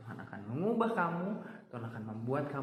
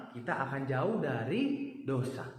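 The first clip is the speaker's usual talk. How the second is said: ordinary talk, nothing marked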